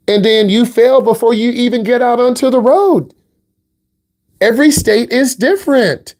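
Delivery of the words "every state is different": ordinary delivery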